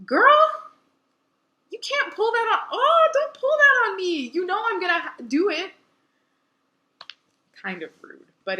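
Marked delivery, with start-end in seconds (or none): 0.1-5.7 put-on much higher, loud sassy girl voice; sassy friend. Rangi scolding Kyoshi for pulling rank, high and loud. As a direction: squeal it like an exasperated best friend. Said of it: "And Rangi's like".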